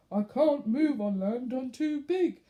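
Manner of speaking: lightly voiced whale moaning; mock moan of a stuck whale. A narrator's reading. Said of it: "moaned the whale".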